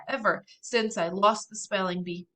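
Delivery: usual speaking voice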